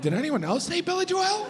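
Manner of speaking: high-pitched, worried questioning; worried self-doubt. Retold or imitated. Frantic inner doubt about pronunciation.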